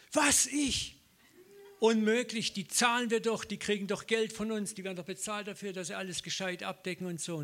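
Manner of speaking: high, loud outraged whine "Was, ich?"; mock indignant self-justifying whine; indignant protest. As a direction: protest like someone wronged. Indignant own inner protest.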